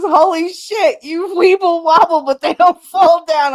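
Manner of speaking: high, loud imitation of a teasing onlooker; mock gleeful shout. Co-worker heckling her wobble on the stairs, mock gleeful shout. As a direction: call it out loudly, high and amused. Marty calls out, retold.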